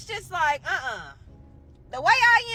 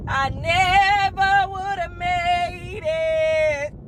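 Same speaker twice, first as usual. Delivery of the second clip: drawn-out laughing shout; 5 dB louder, much slower than usual. Real, not acted. Telling viewers she'd never have made it, drawn-out laughing shout. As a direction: stretch it out slowly and yell it through laughter. Laughing disbelief at having kids.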